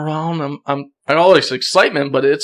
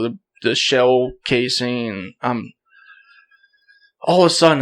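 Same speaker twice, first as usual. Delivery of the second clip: ordinary delivery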